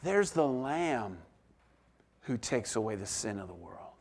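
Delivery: hushed, reverent reading; quiet and reverent. Shown as a demonstration. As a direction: let the words sink in with awe. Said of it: "He says".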